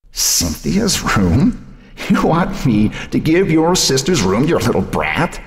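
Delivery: scoff, then swear it fiercely; scornful grandmother. A narrator's reading. Grandmother refusing Joan the dead sister's room, scornful.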